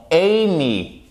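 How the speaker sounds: Amy drawn out, stressed on the first syllable; accent tonique. Shown as a demonstration. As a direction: lean slowly on the EY of Amy. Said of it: "vous avez l'accent tonique ici". (Amy, première syllabe accentuée)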